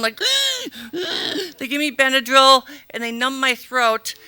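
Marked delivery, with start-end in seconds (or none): 0.3-1.5 high strained gasp; choking gasp. Retold or imitated. Choking patient answering hospital staff with a high gasp. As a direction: gasp for breath.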